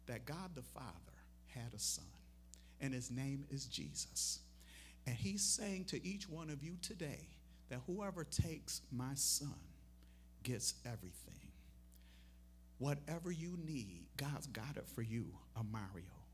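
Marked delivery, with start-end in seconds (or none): none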